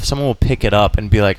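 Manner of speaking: ordinary talk, nothing marked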